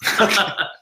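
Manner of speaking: a sudden high giggle bursting out; high burst of laughter. Real, not acted. Medium laughing at what the spirit shows, high giggle. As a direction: laugh out loud, caught off guard.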